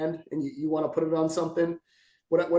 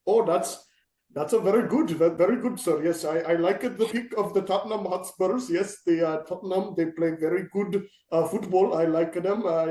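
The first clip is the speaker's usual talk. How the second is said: lightly put-on Indian English accent, shopkeeper style; a mild Indian accent, playing a friendly store clerk. Cohost doing his "new UK accent" as a shop clerk, lightly Indian-accented. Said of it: "a little different angle at an English accent".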